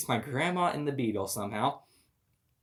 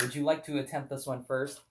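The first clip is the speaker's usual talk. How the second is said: normal talking voice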